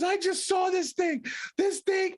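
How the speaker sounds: high-pitched mock panic; imitating shaking wife. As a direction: mimic a frightened wife exaggerating what she saw.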